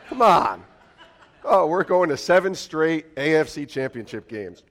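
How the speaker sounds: usual speaking voice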